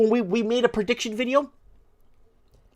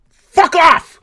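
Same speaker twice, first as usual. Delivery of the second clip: much higher and louder enraged shout; furious yell; 10 semitones higher, 14 dB louder than usual. Real, not acted. Host dismissing a caller's election claim, furious yell. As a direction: bellow it out in disgust.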